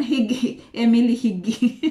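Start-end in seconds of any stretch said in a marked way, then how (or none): none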